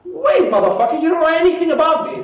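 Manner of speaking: high, fast indignant protest; indignant customer. Acted. Customer to pushy salesperson, indignant. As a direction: cut them off in mock outrage.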